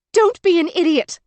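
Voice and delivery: high, loud angry scream; exasperated scream; screaming to be heard. A narrator's reading. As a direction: yell up over the distance. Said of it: "screaming to be heard".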